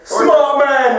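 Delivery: high, loud cheer of approval; excited approval. Real, not acted. Friend cheers on his mate's first-date plan, excited approval. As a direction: call it out high and loud, hyping him up.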